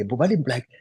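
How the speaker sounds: ordinary talk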